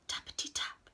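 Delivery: hushed tap-tap sound words; whispered tapping. A narrator's reading. Storyteller voices knocking at Owl's door, whispered.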